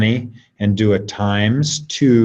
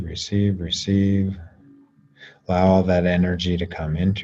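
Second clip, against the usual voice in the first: guided meditation; 4 dB quieter than usual. Acted. Teacher guiding the class to receive energy, soft meditation voice. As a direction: ease them into receiving, gently and softly.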